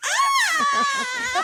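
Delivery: put-on high startled child shriek. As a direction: scream like a pranked kid. Kids shriek at the slap.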